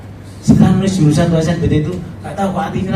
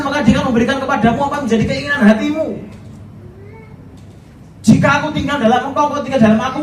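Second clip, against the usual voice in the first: protesting son; 11 semitones higher, faster than usual. Retold or imitated. Son challenges father over God's promise, high indignant. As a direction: quote the verses back like a betrayed teen.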